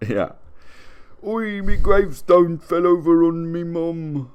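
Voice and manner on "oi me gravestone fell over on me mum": high, loud mock Cockney; hammed-up English commoner; Cockney accent.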